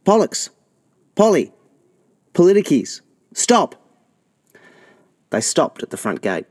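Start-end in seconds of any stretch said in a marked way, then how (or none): 0.1-3.8 put-on much higher, louder calling after the boys; mum yelling. A narrator's reading. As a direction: shout from the door to stop them. Mother yells from doorway.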